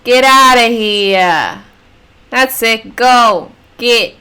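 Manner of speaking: loud, drawn-out New York "get outta here"; New Yorker accent. Acted. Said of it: "she's suddenly a New Yorker".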